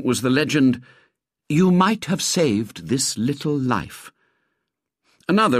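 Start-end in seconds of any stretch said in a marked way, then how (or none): 1.5-4.1 slow, higher-pitched declaiming; drawn-out appeal; solemn poster slogan. A narrator's reading. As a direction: read it out as a pleading slogan. Reading out a poster legend.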